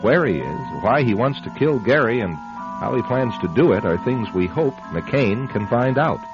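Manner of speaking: usual speaking voice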